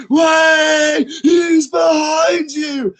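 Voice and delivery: high, loud, slow yell; full-throated shout. Retold or imitated. Tipsy parents yelling back at the pantomime stage. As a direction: roar it with the whole crowd. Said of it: "they're like".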